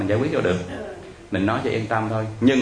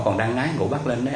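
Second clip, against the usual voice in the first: normal talking voice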